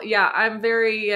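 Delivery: normal talking voice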